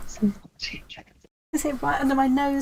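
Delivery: slow half-whispered grumble; whispered mutter. Real, not acted. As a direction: mutter to yourself under your breath. Muttering while searching.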